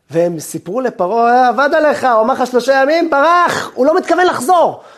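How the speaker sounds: high, loud alarmed report; urgent tattling. Acted. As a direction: break the news like an informer. Informants report the escape to Pharaoh.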